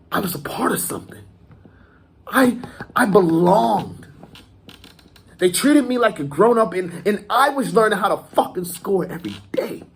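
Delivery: high, insistent, swelling boast; impassioned pride. Acted. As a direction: declare it with fervent pride. Acted monologue, proud of belonging.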